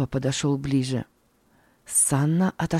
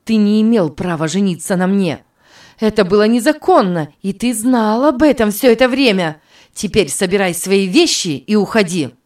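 louder, higher, stern demand; stern and clear; 4 semitones higher, 7 dB louder than usual. Acted. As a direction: throw him out firmly. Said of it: "Её голос был ясен и суров".

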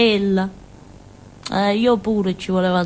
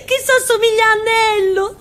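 giggly excitement; 11 semitones higher than usual. Acted. Giggly comparison to Nello.

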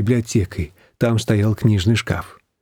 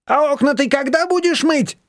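much higher, stern neighbour's question; strict; 14 semitones higher than usual. A narrator's reading. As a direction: check up on her sternly. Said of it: "строго спросила Рима".

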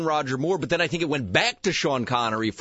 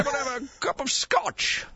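higher mock Connery burr; Connery Scottish accent; 10 semitones higher than usual. Host mimicking Bond for cohosts, mock Scottish accent. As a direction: play Bond asking for scotch. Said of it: "That's how Bond, James Bond would say it".

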